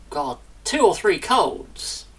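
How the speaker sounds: ordinary talk, nothing marked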